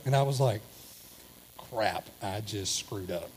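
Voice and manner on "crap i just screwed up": low, soft, slow mutter of dread. Retold or imitated. Husband realizing he smarted off to his wife, low mutter. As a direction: mutter it as it sinks in.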